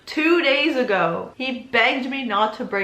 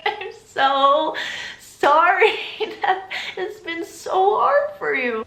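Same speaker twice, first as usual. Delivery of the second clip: higher, louder mock apology; sarcastic sympathy; 7 semitones higher, 4 dB louder, slower than usual. Girlfriend answering boyfriend's self-pitying complaint, sarcastic sympathy. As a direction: drag out the exaggerated pity.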